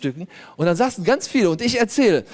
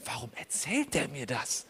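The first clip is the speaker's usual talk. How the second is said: inner thought; 4 semitones lower, 13 dB quieter than usual. Retold or imitated. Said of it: "Und ich denk mir".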